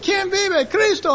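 high-pitched call and answer; retold call-and-response. Preacher retelling his exchange with a crowd, high-pitched calls. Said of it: "So I said".

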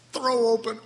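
hammed-up cry climbing high; mock exclaiming. Preacher mocks throwing every door open for God, exaggerated cry. Mock grand gesture of opening up.

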